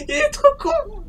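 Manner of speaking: high, crying with laughter; laughing. Real, not acted. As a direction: squeal it through laughter. Laughing at enemy.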